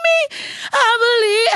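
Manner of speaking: high, loud, tearful cry of belief. Retold or imitated. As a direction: cry it out, overwhelmed with emotion. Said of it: "I'm like".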